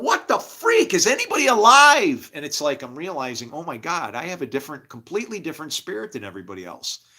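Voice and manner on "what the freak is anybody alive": loud, high incredulous shout; exasperated yell. Acted. Speaker sitting alone calling for anyone alive, exasperated yell. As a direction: yell out to see who's there.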